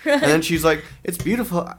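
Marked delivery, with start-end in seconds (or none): 1.0-1.7 high-pitched mock London accent; high, lilting, muddled London accent; attempted London accent. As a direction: imitate a waitress in a bad London accent. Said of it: "London accent".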